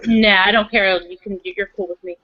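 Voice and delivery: ordinary delivery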